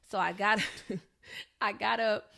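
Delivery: ordinary delivery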